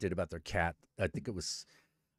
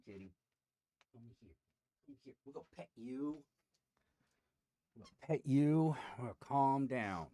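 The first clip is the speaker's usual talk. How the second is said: soothing the cat; 7 dB quieter than usual. Real, not acted. Streamer hushes his restless cat on the desk, soft and soothing. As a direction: settle the cat gently.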